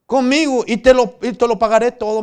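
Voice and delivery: clamor alto y alargado pidiendo compasión; súplica actuada. Acted. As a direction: ruega a voces como siervo endeudado. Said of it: "le suplicó diciendo". (ruego dramatizado a viva voz)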